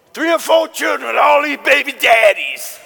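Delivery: full-force pulpit shout; preaching. Acted. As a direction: cry out the warning to the youth.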